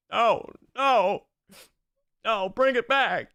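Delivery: high, drawn-out mock crying plea; crying Gojo voice. Streamer hamming a line for the clips, mock crying. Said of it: "you crying Gojo".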